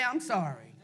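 low and soft, barely said; humble apology muttered low; small apology. Shown as a demonstration. Models the hard apology.

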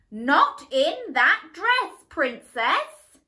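loud, slow royal scolding; stern queen. Acted. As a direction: lay down the law like a strict queen. The Queen always scolds her.

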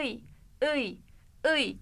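Iy said as ı gliding into i; ı-i glide. Shown as a demonstration. Teacher shows the vowel sound to the student. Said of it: "Böyle ı, i gibi".

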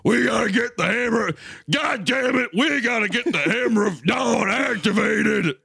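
high, hammed-up soldier yell; mock frantic shout. Soldier urging the Hammer of Dawn, mock frantic shout. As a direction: yell orders high like an overacted game hero.

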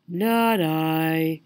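yawning lazy cat voice. Acted. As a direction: say it through a yawn. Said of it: "yawned the lazy orange cat".

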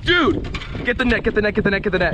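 high, rushed, urgent pleading; urgent excited calling. Real, not acted. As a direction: hurry your buddy to grab the net. Urging partner to net fish.

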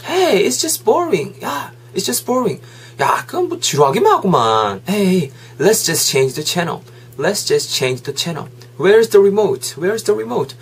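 high, whiny-toned grumble to a friend; bored complaint. Acted. Friend pushing to change the boring channel, bored and impatient. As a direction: complain that the show is dull, pitch lifted.

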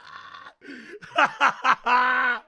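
howling laugh; loud burst of laughter. Real, not acted. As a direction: crack up loudly. Bursts out after the act.

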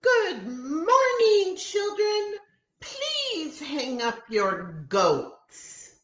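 high, slow, sing-song teacher voice; a sweet, silly teacher greeting the class, drawn out; high singsong teacher; Missus Millie's voice. A narrator's reading. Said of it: "Every day she says".

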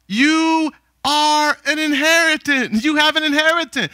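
full-voiced sermon climax; preaching at full force. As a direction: proclaim it to the congregation.

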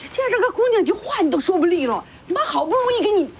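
high, heated motherly telling-off; worked-up, shrilly pitched reproach; exasperated scolding. Acted. Mother scolds her son.

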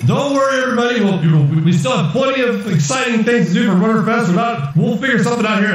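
high, rambling announcer patter; reassuring announcer. Acted. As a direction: placate the audience in a high showman's voice. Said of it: "And he's saying".

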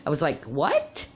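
ordinary talk

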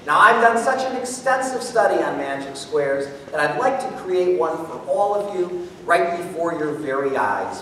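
usual speaking voice

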